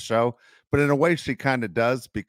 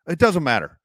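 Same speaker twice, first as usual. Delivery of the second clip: louder dismissive snap; snappy reply; 5 semitones higher, 5 dB louder than usual. Retold or imitated. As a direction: brush it off sharply. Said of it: "the other girl's like".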